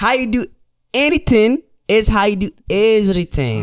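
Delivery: high, slow, loud Habesha accent. Shown as a demonstration. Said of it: "Let me say it in my".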